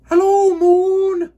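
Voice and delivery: high, loud, slow call up to the sky; shouted greeting. Acted. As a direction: yell it up at the moon. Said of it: "he shouted".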